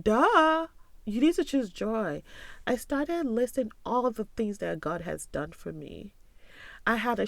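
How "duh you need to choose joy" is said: high, loud playful duh; mock duh. Scolding her past self playfully, high and loud. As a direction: tease yourself for missing it.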